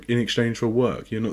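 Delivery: usual speaking voice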